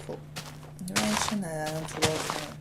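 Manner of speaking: ordinary delivery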